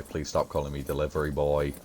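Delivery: ordinary delivery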